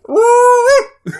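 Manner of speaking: very high loud hillbilly-style whoop; silly accent whoop. Acted. Host answers her co-host with a goofy accented whoop. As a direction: holler it in a goofy put-on accent. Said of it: "I don't know why we did that accent".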